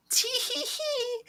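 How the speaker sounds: put-on very high, tiny baby giggle; soft, drawn-out squeaky titter of a toddler; Melinda. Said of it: "in her high chair going".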